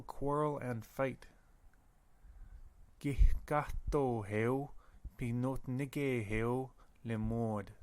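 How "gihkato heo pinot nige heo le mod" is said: Gihkato heo said slowly, syllable by syllable; slow demo. Shown as a demonstration. Teacher modelling a Michif word for students, slowly. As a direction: spell it out in sound for the class. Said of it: "teacher models Michif slowly".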